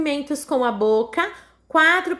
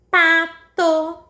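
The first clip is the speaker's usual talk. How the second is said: "pato" in two loud, higher, even beats, pa-to; sílabas separadas; 4 semitones higher, 4 dB louder than usual. Shown as a demonstration. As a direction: say "pato" as two distinct syllables. Teacher splits word into syllables. (pato dito sílaba por sílaba)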